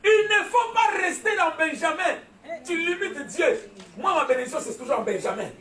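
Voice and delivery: high, loud, pounding sermon delivery; full-force preaching. Acted. Preacher rousing his congregation, shouting.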